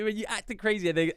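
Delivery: ordinary talk, nothing marked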